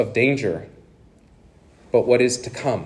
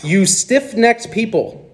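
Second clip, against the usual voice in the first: confrontational rebuke; 8 semitones higher, much slower than usual. Acted. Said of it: "Listen to how he, he talks".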